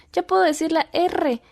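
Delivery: put-on high-pitched little-girl boast; proud child. Daughter tells mom she can say R, proud. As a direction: announce a new skill excitedly.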